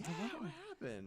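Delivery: high feigned innocence; mock innocent reply.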